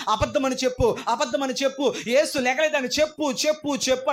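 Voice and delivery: voiced tormentor demanding denial; demanding persecutor. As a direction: press him hard to deny.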